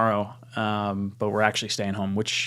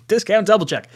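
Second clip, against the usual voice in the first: excited; 9 semitones higher, 3 dB louder than usual. Real, not acted. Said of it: "Getting too excited".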